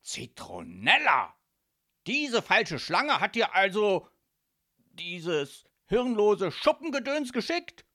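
loud outrage climbing in pitch; incensed father ranting about his ex; agitated shouting. A narrator's reading. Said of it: "rief er aufgebracht".

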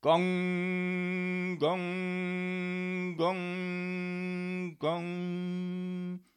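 long, ringing sung "gong" strokes; sustained bell tone on one note; tower clock strikes. A narrator's reading. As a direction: imitate a midnight bell, humming each stroke out. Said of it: "schlug die Turmuhr".

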